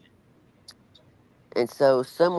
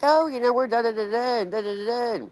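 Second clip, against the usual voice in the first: chirpy cheerful reply; 9 semitones higher than usual. Retold or imitated. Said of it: "But we're like".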